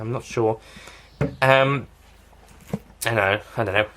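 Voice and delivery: ordinary talk, nothing marked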